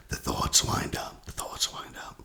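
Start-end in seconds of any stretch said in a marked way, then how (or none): 1.2-2.2 much softer, breathed out as a whisper; whispered repeat. Shown as a demonstration. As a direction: let the echo fade into a whisper. Repeats phrase, second time whispered.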